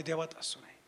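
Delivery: very soft and low, dying away; hushed imitation of a troubled caller; retold tearful confession. Preacher finishing the weeping sister's confession, hushed. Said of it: "परत रडत आहे".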